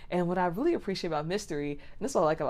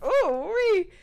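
high, loud, delighted ooh-wee; flattered squeal; 13 semitones higher, 6 dB louder than usual. Retold or imitated. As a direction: squeal it like you're thrilled by the attention. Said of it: "I was just so flattered".

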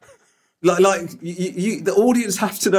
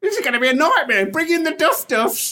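mock soap extra; 9 semitones higher than usual. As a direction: play along as the fretting extra. Joins the soap extra bit.

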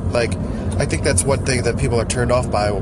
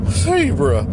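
weird character voice; 3 semitones higher than usual. Acted. Repeats character's word.

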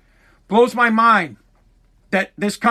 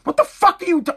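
high, incredulous what; mock disgusted double take; disgusted disbelief; 8 semitones higher than usual. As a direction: glare at the committee in shock. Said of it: "look on my face of disgust".

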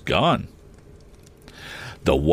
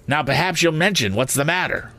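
high, exasperated scolding sister; out of breath; 9 semitones higher than usual. A narrator's reading. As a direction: demand an answer, pitched high. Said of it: "said my sister out of breath".

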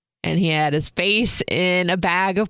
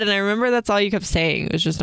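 ordinary talk

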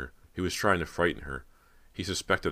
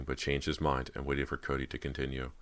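plain reading voice

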